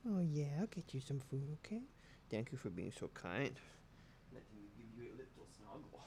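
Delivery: hushed tender pet talk; soft cuddle talk. Real, not acted. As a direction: murmur it close while snuggling. Cuddling the cat.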